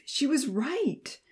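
ordinary delivery

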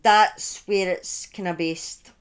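usual speaking voice

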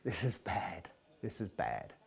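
low, quiet mutter of dread; acted hushed alarm, kept low. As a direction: freeze and say it under your breath. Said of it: "Grog and Stead freeze and go".

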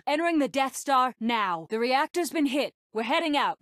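high-pitched cockpit report; urgent battle callout. Acted. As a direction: report the hit urgently to the squadron. Battle callouts during attack run.

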